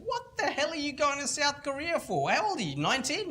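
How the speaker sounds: high, disbelieving what-the-hell, with a laugh; incredulous. Retold or imitated. Mentor asks nineteen-year-old why South Korea, amused disbelief. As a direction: throw the question back in amused disbelief.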